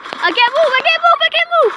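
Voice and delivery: high, panicky repeated plea; panicked cry. Real, not acted. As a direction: cry out in panic that you're stuck. Panics, surrounded in game.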